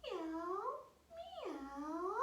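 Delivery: high, long kitty meows; cat meow. Pretend. Teacher repeats cat pose with the children, high cat meow. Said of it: "we can meow together".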